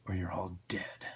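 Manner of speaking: low, hushed death threat; menacing Spawn. Acted. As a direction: threaten them low and soft.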